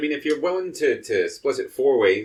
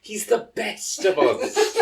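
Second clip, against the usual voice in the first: laughing; 11 semitones higher, slower than usual. Real, not acted. Fond remark with laugh.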